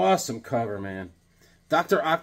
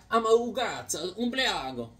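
mock Italian; 4 semitones higher, 6 dB quieter than usual.

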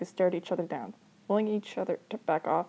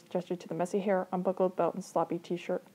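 usual speaking voice

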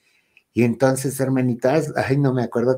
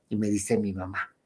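usual speaking voice

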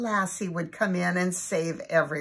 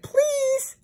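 begging dog. A narrator's reading. Lassie begging for cookie.

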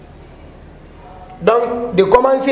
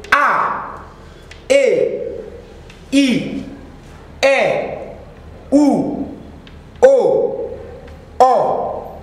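alphabet recitation; 3 semitones higher, 5 dB quieter, much slower than usual. Shown as a demonstration. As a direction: recite each letter clearly with a pause after it, like a teacher. (récitation de l'alphabet nko, lettre par lettre)

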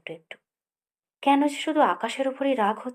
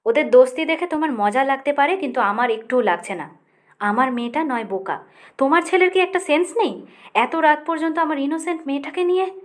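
angry shout, higher and louder; 5 semitones higher, 6 dB louder than usual. A narrator's reading. Rohini yelling at Akash about her daughter, angry shout. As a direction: lose control and yell at him. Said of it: "চেঁচিয়ে উঠলাম".

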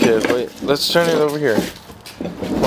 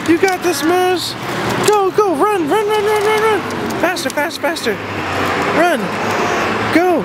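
excited cheering. Real, not acted. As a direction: cheer her on at full speed. Cheering child running.

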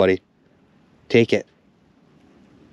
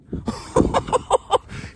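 high breathy disbelieving laugh; 3 dB quieter than usual. Real, not acted. Can't believe the catch.

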